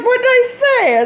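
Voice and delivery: innocent surprise. Retold or imitated. Sister baffled by laughter, high and loud puzzled question. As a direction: sister asking what she did, high. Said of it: "She's like".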